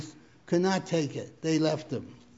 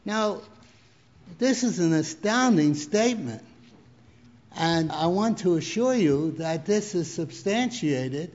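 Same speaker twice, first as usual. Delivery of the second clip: normal talking voice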